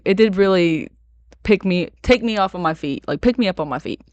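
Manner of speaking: ordinary talk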